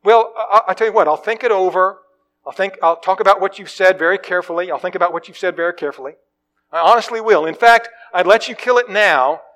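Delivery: high, loud, hurried excuses from a frightened ghost; a cornered ghost talking fast to dodge the angel; nervous ghost man. Acted. Ghost man putting off the angel, high and loud.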